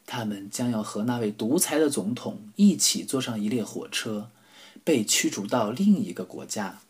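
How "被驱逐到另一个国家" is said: plain reading voice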